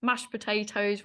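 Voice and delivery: ordinary talk, nothing marked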